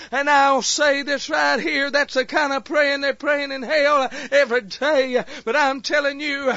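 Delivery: loud, urgent sermon delivery; fiery preaching. Acted. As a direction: preach it hard to the congregation. Preaching at full force.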